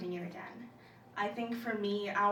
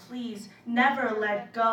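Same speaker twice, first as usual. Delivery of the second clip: louder, heartfelt poetic plea; begging; 2 semitones higher, 8 dB louder than usual. Acted. Friends holding each other tightly, begging plea. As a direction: plead it out, voice swelling. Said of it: "begging each other".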